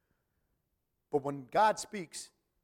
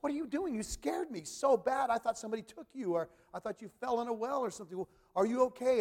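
a hint of a frantic scolding parent, pitched higher; worried parent; 6 semitones higher than usual. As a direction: scold the kid in relief and fear. Parent scolding lost child.

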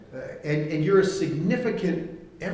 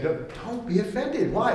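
gentle urging Jesus; 6 semitones higher than usual. Retold or imitated. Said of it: "Jesus is saying".

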